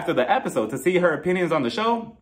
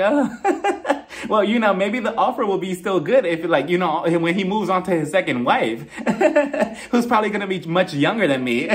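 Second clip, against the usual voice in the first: mock joking laugh; 2 semitones higher than usual. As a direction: pass off a pointed remark as a joke. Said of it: "she's making a joke out of it".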